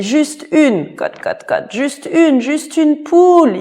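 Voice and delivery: high, loud cry with clucking; shouted plea. Acted. Master cries after the guest, high and loud, clucking. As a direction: yell after him. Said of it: "il criait".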